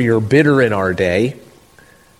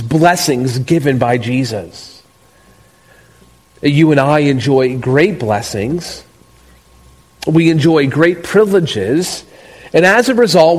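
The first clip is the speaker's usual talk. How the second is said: normal talking voice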